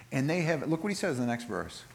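normal talking voice

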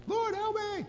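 high, desperate acted scream for help; full-on scream. Peter to Jesus as he begins to sink, screaming. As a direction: scream it as if going under. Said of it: "this is a full-on scream".

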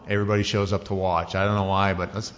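ordinary talk, nothing marked

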